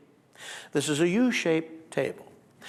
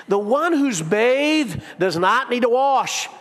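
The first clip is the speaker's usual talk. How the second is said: slow patient correction; 6 semitones higher, 6 dB louder, slower than usual. Acted. Jesus patiently corrects Peter.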